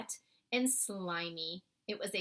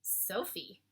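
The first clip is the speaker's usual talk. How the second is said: plain reading voice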